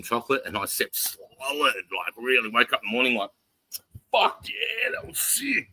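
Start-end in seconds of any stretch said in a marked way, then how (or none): 4.1-5.7 a high, elated burst on waking; mock elated. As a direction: crow it out like you slept great. Retelling waking up refreshed.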